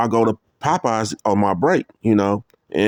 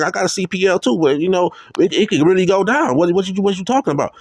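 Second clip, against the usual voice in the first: heated challenge; 8 semitones higher than usual. Retold or imitated. Heated phone argument, gun threat.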